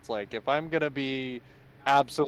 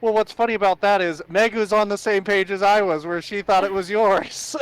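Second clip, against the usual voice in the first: higher, louder laughing telling; 9 semitones higher, 9 dB louder than usual. Real, not acted. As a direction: tell it grinning. Laughing retelling.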